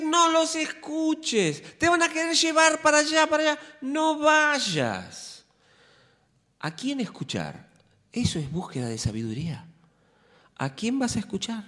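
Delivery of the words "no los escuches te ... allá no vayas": high mocking voices pulling you away; mock the voices that lure you off; tempting voices. Preacher voicing the tempters calling the flock, high and mocking. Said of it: "Te van a decir".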